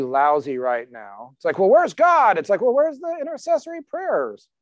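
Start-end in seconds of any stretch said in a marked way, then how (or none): 1.6-2.4 high, louder exasperated mock whine; imitating people who blame God, a mock exasperated cry; mock complaint. Mock complaint about lousy times. 2.6-4.5 higher-pitched mock retort; God answering the grumblers, a mock pointed retort.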